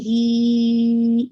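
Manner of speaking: ہی drawn out four to five counts; long vowel. Shown as a demonstration. Teacher modelling an elongated ہی, held long. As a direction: hold the ی in ہی for five beats. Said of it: "ہی کو آپ نے پورا four to five count رکھنا ہے".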